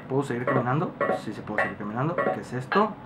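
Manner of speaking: low, soft talking to oneself; cautious undertone. Real, not acted. Player checking if it's safe to walk, cautious low voice. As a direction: keep it down, low and wary. Cautious exploring after a scare.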